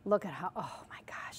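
soft, breathless whisper of wonder; awed whisper. Real, not acted. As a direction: whisper it as if amazed by the cream. Awed by the whipping cream.

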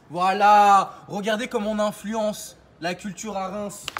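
ordinary talk, nothing marked

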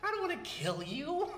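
cackling, high and loud; manic laugh. Acted. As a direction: laugh it off madly. Joker laughs during interrogation.

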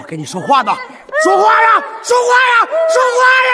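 very loud high furious yelling; shouting. Acted. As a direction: scream at her to answer. Brother screams for an answer.

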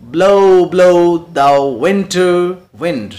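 loud, slow, both "Blow" words punched; spondee stress. Shown as a demonstration. Teacher demonstrating the spondee to students, loud and slow. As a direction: hammer each "Blow", drawn out. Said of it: "blow और blow के ऊपर stress है".

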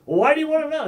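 loud, high-pitched defensive retort; defensive challenge. Acted. Confused person questioned about their worldview, mock-defensive outburst. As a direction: fire the question back, acting affronted. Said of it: "they do become like".

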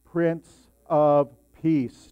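slow, drawn-out Prince of Peace; congregation call and response. Shown as a demonstration. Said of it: "say that last line with me".

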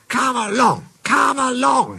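high, chanted engine call; high rhythmic storybook shout; puffing engine. A narrator's reading. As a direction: puff it out like an engine urging trucks on. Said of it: "he puffed to the trucks".

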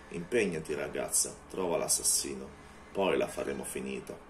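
a lightly voiced menacing vampire. Vampire orders her.

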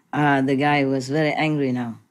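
ordinary talk

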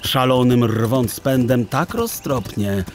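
plain reading voice

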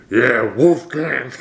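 slow, drawn-out try at a booming Wolfgang; attempted deep voice. Shown as a demonstration. Reactor trying Felix's deep Wolfgang voice, slow and drawn out. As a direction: stretch the name out, aiming for a deep growl. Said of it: "Yo, let me try that".

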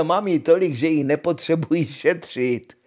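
Honza hlasitě a sebejistě; hlasité vychloubání; chvástavý Honza. Acted. Honza boasts boisterously. (Honza dál nahlas chlubivě)

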